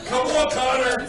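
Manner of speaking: loud cheering. Real, not acted. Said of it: "I know, I'm an inside voice".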